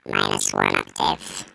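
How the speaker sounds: deep voice effect; octave down voice. Shown as a demonstration. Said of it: "we got various settings".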